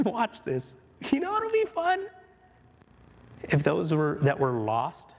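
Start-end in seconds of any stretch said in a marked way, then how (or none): 1.1-2.1 high, bright gleeful question; playful owner. Acted. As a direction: tease out the fun idea.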